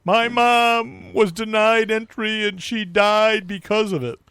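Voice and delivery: high, slow, drawn-out mock lament; mock aggrieved wail. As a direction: wail it like a hammed-up grieving son. Acts out the grieving man's claim.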